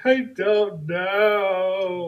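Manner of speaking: high, loud, very drawn-out mock bawl; imitated crying child. Little girl asked why she's crying, mock bawling. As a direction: bawl it slowly like a little kid. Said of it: "and she's crying".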